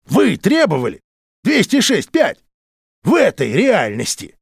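slow, scornful mockery of the mighty; mocking rant. A narrator's reading. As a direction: jeer at them through laughter. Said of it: "внезапно расхохотался Уоллер".

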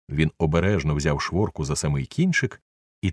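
ordinary delivery

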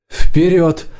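high-pitched command; giving an order. A narrator's reading. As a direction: order the mount forward. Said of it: "приказала Нила".

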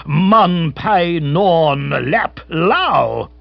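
impatient colonial captain in dialect; impatiently. A narrator's reading. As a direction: rap it out at someone who won't catch on. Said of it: "he said impatiently".

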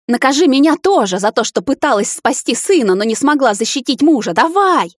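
high, loud, building to a cry; furious challenge. A narrator's reading. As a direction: dare him to punish her. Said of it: "дрожа от ярости". (мать в ярости, нарастая к крику)

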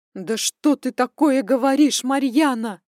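loud startled cry; shocked exclamation. Acted. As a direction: cry out in disbelief. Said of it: "воскликнула она в трубку".

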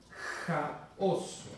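"jaoso" started with a rasped throat h, unvoiced; demo of ĥaoso. Shown as a demonstration. Teacher demonstrating ĥ in ĥaoso to the class, rasped. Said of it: "con circunflejo".

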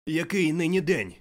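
high, loud hail; calling out. A narrator's reading. Scrooge at the window calling down to a boy. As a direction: shout down to someone below. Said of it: "гукнув Скрудж".

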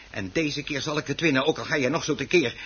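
ordinary talk

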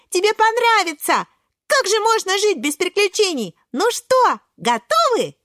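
put-on high, urgent, excited child character; eager pleading Шпинатик. Шпинатик persuading Кабачок to run along, high and pleading. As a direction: coax them along in a high, bouncing voice.

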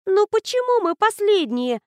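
high, loud whine on почему; a whiny girl complaining, lightly voiced; whining. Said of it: "проныла Катриона".

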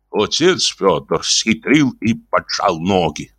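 plain reading voice

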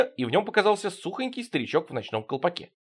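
ordinary delivery

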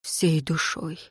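low, soft devotion; devoted. A narrator's reading. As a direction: answer softly with devotion. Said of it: "преданно глядя на Лейру".

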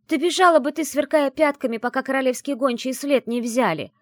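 high, loud, vivid scolding; emphatic retort. A narrator's reading. Friend picturing the witch fleeing, emphatic retort. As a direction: drive the point home loudly.

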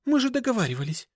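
quick, high-pitched protest; aggrieved rapid objection; indignant. A narrator's reading. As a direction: protest fast in a high girl's voice. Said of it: "возмутилась Даша".

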